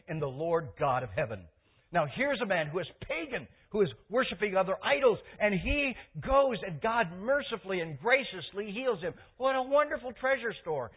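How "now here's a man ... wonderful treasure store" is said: loud, high, impassioned preaching; rousing sermon delivery, high and loud; preaching at full force. Real, not acted. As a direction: proclaim it to the whole congregation.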